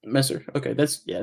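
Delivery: normal talking voice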